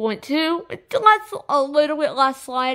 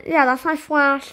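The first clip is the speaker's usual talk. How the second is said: ordinary talk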